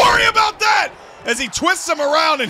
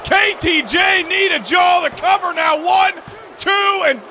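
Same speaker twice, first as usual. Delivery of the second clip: excited count; 6 semitones higher, 3 dB louder than usual. Real, not acted. Calling the pin count.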